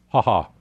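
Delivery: brief amused chuckle; a quick real laugh; short laugh. Real, not acted. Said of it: "I have to laugh".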